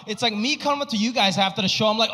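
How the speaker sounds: normal talking voice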